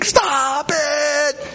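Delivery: high mock scream of pain; imitated scream. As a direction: act out a victim begging, pitched high. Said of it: "he's screaming".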